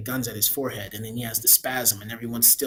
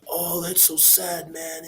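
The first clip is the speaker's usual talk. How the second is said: slow, higher, mocking sad sigh; sarcastic slow sad voice; mock sadness; 5 semitones higher, slower than usual. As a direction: drag it out like a fake-moved viewer. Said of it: "you're just immediately like".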